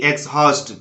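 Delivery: "एग्जॉस्ट" misread as eks-haust, h sounded; wrong pronunciation. Shown as a demonstration. Teacher showing learners what to avoid, wrong pronunciation. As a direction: say "एग्जॉस्ट" with ks and an audible h. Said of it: "ना कि".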